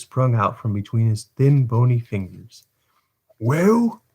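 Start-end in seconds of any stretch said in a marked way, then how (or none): 3.4-4.0 put-on a high, rising "Well?" thrown at the boy; sharp exclamation. A narrator's reading. Bumble snaps at the weeping boy, rising high. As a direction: demand it, voice shooting up. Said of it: "exclaimed Mister Bumble".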